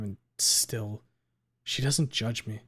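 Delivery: ordinary delivery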